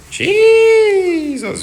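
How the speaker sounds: sudden high loud exclamation; shocked outburst. Real, not acted. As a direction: blurt it out in disbelief. Shock at the chart moving.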